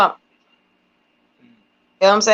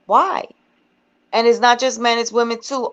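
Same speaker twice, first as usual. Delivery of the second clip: ordinary delivery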